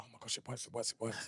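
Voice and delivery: hushed, thrilled whisper; whispered excitement. Retold or imitated. Parents whispering that the onion cure works, thrilled. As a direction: whisper it like a secret miracle. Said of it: "We were like".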